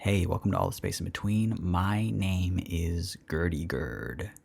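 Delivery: low, soft, drawn-out sexy host; hushed sultry podcast intro; hot podcast voice. Shown as a demonstration. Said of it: "is this a hot podcast voice".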